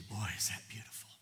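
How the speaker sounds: hushed, breathy wonder; awed whisper. Pretend. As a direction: whisper it as if struck by beauty. Awed response at a lily.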